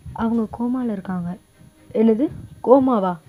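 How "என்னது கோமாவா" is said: louder, stunned outburst at the news; shocked disbelief. Acted. Hearing she is in a coma, loud shocked disbelief. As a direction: gasp out the question in disbelief.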